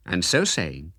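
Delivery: usual speaking voice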